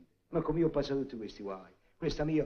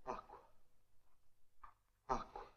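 weak, much softer gasp for water; much softer parched plea for water; faint plea; 20 dB quieter than usual. Acted. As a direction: barely get the word out. Exhausted returning husband begs.

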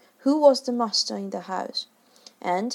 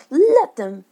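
recklessly; 5 semitones higher, 8 dB louder than usual. A narrator's reading. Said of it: "he answered recklessly".

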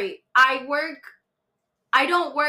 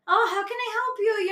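high-pitched put-on service politeness; customer service voice; 9 semitones higher than usual. Server to a customer standing too close, high customer service voice. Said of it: "customer service voice".

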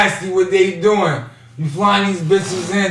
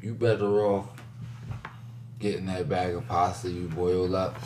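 quiet; 8 semitones lower, 12 dB quieter than usual. Real, not acted. Lowered voice giving advice.

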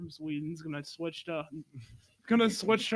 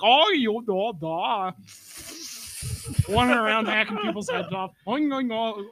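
high, loud nonsense Swedish babble; hammed-up singsong Swedish gibberish; mock elfish Swedes; 4 semitones higher, 5 dB louder than usual. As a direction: mimic silly elf-like Vikings on the attack. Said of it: "Swedish people talk like elves".

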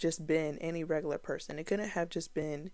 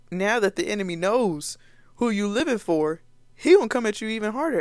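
fired-up preaching; 4 semitones higher, 8 dB louder, faster than usual. Real, not acted. Preacher builds to a warning.

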